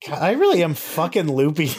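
normal talking voice

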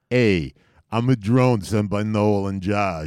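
put-on thick Brooklyn accent, slow; a tough drone in Brooklyn accent. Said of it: "a thick Brooklyn accent".

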